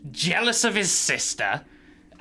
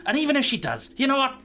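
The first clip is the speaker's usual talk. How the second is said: irate god; 7 semitones higher than usual. Acted. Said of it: "He's like".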